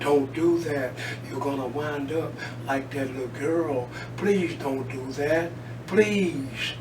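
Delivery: quiet pleading. Real, not acted. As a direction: drop the voice and beg gently.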